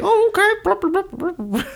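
very high, silly falsetto; cartoonish eager acceptance, fading off; mock gullible agreement. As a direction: babble along in a high voice. Voices gullible guests falling for it.

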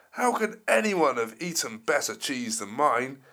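loud roaring dinosaur voice; angry T-Rex roar. Acted. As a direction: bellow like a furious T-Rex. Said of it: "a very angry dinosaur called T-Rex".

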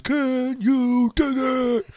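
slow, high, drawn-out jive line; 1940s jive talker. Retold or imitated. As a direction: drawl it like a cool gang leader. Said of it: "He goes".

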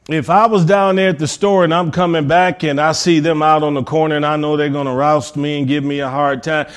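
ordinary delivery

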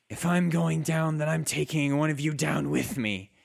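wounded drow's threat. Acted. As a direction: threaten them as a wounded drow warrior. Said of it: "spits out some blood and says".

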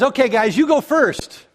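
mock-generous offer, pitch climbing at the end; lightly sarcastic challenge. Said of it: "got just a little bit sarcastic".

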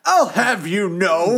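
high, slow, drawn-out mock protest; mock indignation. Co-host echoing a friend's protest, mock-indignant. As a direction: draw it out in playful indignation.

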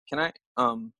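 timid stop-and-start mumbled plea; sheepish, timid. Retold or imitated. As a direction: imitate a hesitant, halting request. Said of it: "he's kinda sheepish".

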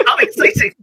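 high, laughing delight; delighted squeal. Real, not acted. Player squealing with delight over the sausage feast.

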